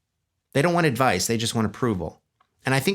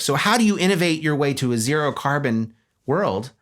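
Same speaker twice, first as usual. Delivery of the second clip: normal talking voice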